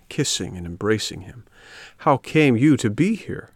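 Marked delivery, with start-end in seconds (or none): 2.0-3.5 higher, livelier questioning voice; inquiring fox. Acted. Fox questioning the puppet, higher eager voice. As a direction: ask it eagerly, pitched up.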